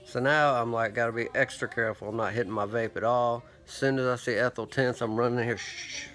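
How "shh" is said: a sharp breathy shush. Shown as a demonstration. Telling himself to keep quiet, a soft shush. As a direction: hush the room with one quick shh. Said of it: "like that right there".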